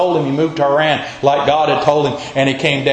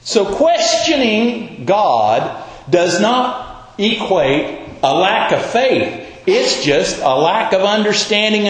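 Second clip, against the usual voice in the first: ordinary talk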